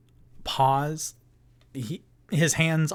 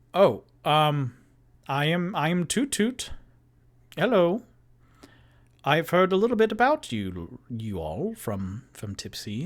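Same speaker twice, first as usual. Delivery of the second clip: friendly and a little flustered welcome; bubbly Toot Toot; 3 semitones higher than usual. Acted. GM voices Toot Toot greeting.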